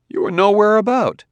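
high, loud protest of disbelief; confused outburst. A narrator's reading. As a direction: burst out in bewilderment, pitched right up. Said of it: "Bailey was thoroughly confused".

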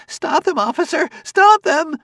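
high shrill shriek. A narrator's reading. Store manager shrieking at the officer. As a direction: shriek for help at full pitch. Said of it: "The manager was shrieking".

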